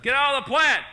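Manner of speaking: high loud shout; yelling at the cat. Retold or imitated. Owner scolding drugged cat in plant, high shout. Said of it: "That's it".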